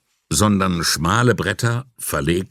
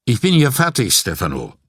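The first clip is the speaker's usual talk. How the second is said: curt, louder and raised in pitch; gruff; 5 semitones higher, 4 dB louder than usual. A narrator's reading. Technician tells Stefano he's finished, gruff. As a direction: announce it brusquely, dropping on the name. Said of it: "sagte er barsch".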